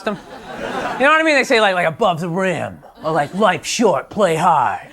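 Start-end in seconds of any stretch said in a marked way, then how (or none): 1.9-4.9 lower, put-on macho read of the slogans; mock tough-guy slogan voice. Comic mocking the slogans on sports t-shirts, macho put-on voice. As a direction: sneer the slogans like a jock.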